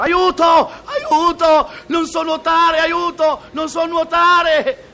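loud high panicked yell of Aiuto!; shouting for help. Acted. Drowning man on a sinking boat shouts to the shore, loud panicked yell. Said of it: "grida l'uomo".

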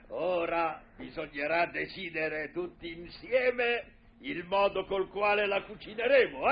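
normal talking voice

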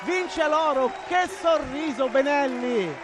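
high, loud breathless celebration; breathless italian goal commentary. Real, not acted. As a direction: ride the joy of the gold. Gold medal celebration commentary.

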